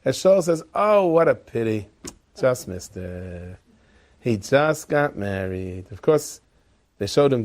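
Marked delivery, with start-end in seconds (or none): 0.7-5.9 drawn out, falling low at the end; mock regret. Shaul to the king who came too late, mock regret. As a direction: feign sympathy, very slowly.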